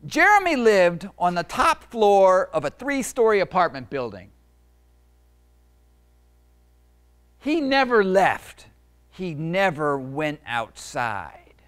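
storybook narration, slow on the last lines; picture book reading. A narrator's reading. Author reading his book to kids, storytelling voice. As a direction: read it to a room of kids.

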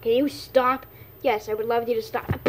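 usual speaking voice

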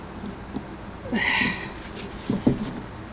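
straining grunt under effort. Real, not acted. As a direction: heave and grunt, pulling hard. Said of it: "sorry for all the grunting".

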